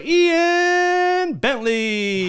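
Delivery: stretched high announcer voice; drawn-out, high showman call; grand announcer. Pretend. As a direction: announce the name like a game show host. Announcing new patron name.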